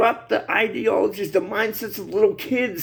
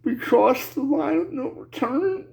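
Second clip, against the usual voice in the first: real tearful voice, higher pitched, breaking off; crying; 4 semitones higher than usual. Real, not acted. Man lamenting the line of no return, crying. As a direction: choke out the words through tears, halting. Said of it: "I'm crying like a bitch".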